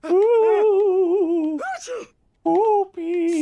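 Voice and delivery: high squealing whoops; playful whoopee. Acted. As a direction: squeal it like a teasing kid. Teasing character with high whoops.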